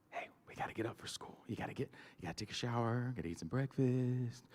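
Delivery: put-on soft whisper to a sleepy child; father whispering to wake his son; whispered coaxing. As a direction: coax him up in a whisper. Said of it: "He'd go".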